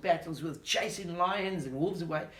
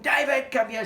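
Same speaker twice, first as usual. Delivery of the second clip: calling out; 8 semitones higher, 9 dB louder than usual. Acted. Father calls David.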